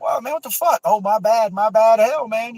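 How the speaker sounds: high, breezy mock apology; hammed-up shrug of a careless guy. Mimicking careless gun handler.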